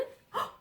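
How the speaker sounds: a big pretend gasp of delight; sharp theatrical intake of breath; playful mock gasp. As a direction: gasp as if amazed for the child. Pretends child found word.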